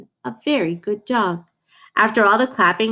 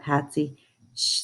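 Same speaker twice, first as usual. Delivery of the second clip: ordinary delivery, nothing marked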